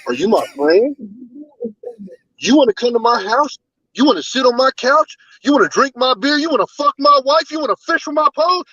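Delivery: high, climbing mocking Filipino chief voice; mock chief's accent. Retelling the chief scolding a sailor, hammed-up accent. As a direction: rattle off the questions, pitch rising each time. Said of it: "motherfucker said".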